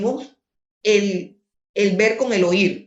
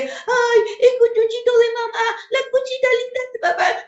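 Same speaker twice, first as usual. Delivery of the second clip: put-on much higher, fast cooing baby talk. Parent cooing baby talk over an infant, much higher. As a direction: coo at the baby in a squeaky sing-song. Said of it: "bebés que dicen".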